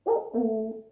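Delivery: उ with lips pushed round; उ shaped with rounded lips. Shown as a demonstration. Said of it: "होठों का थोड़ा सा स्ट्रक्चर चेंज".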